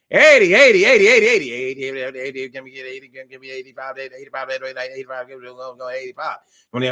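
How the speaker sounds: rapid-fire auction chant; auctioneer. Pretend. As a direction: call out the bids like an auctioneer. Mock auction of bids.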